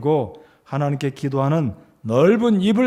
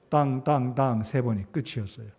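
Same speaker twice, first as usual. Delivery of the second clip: ordinary talk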